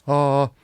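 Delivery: straight high "ah", held level; first tone. Shown as a demonstration. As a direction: sing "ah" on one unwavering high note. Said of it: "it doesn't go up or down".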